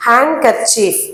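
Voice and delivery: హ్యాండ్ కర్చీఫ్ said as "han-kerchief", d swallowed; silent d. Shown as a demonstration. Teacher showing the swallowed d in హ్యాండ్ కర్చీఫ్. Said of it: "ఆ డి అనేది మనం మింగేస్తాం".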